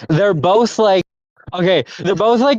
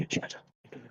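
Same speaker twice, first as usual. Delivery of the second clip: whispered; 22 dB quieter than usual. Retold or imitated. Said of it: "joking Bakugo line".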